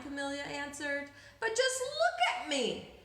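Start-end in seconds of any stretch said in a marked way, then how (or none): 1.4-2.9 distressed. A narrator's reading. Upset girl shows her stripes.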